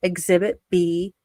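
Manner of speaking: ordinary delivery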